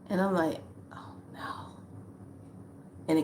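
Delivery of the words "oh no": barely audible, voiceless "oh no"; whispered dread. Retold or imitated. Reacting to the caw outside, whispered dread.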